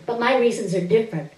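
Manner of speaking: ordinary delivery, nothing marked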